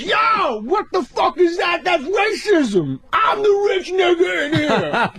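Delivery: high, shouted, outraged street voice; Tracy impression. As Tracy yelling at the waiter, high outraged shout. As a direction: yell it high and indignant, slow on racism. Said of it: "Tracy goes".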